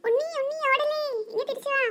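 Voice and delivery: high, thin calling from afar; distant pleading call. Acted. Children begging runaway friend to come back, anxious calling.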